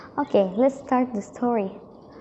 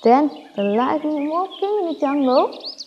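ordinary delivery, nothing marked

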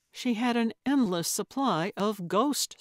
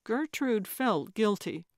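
ordinary delivery, nothing marked

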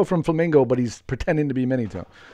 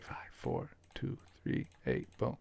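whispering the code to himself; hushed whisper of the digits; 20 dB quieter than usual. Real, not acted. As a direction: breathe the numbers out, no voice. Repeating code to self.

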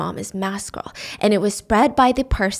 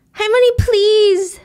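higher, slow, pleading cry; sobbing plea; 10 semitones higher, 4 dB louder, slower than usual. Retold or imitated. Mimo at the gate begging grandma, slow and much higher. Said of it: "she's sobbing".

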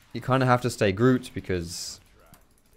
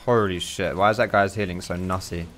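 normal talking voice

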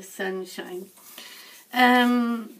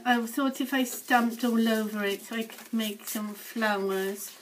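ordinary talk

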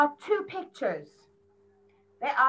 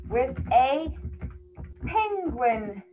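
slow, word by word; slow model sentence; 3 semitones lower, slower than usual. Shown as a demonstration. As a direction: spell it out slowly for the child. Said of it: "We can say".

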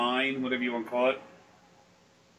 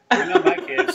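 high, loud, breaking into laughter; laughing retold quote; 14 semitones higher, 10 dB louder than usual. Host retelling partner's pregnancy joke, laughing. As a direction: crack up on the punchline.

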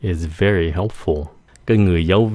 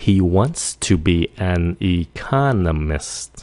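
slowed-down model; slower than usual. Shown as a demonstration. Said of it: "Nếu nói nhanh".

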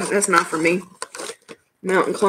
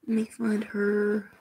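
muttering to herself; 2 semitones higher, 9 dB quieter than usual. Real, not acted. Searching the chat, muttering.

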